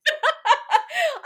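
loud, high shriek of laughter; cracking up at the line; real delighted shriek of laughter; high laugh burst. Real, not acted.

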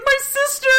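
very high delighted squeal; hammed-up overjoyed sister; joyful cry. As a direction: greet her with a gushing hug. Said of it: "She's like".

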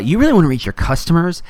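emphatic put-on corporate salesman; weird Facebook voice. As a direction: push the pitch in an odd company voice. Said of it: "that weird Facebook accent or voice".

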